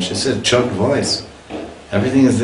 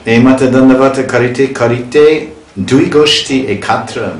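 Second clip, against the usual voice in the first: scripture recitation; 5 semitones higher, 5 dB louder than usual. Acted. Recites Bengali verse aloud.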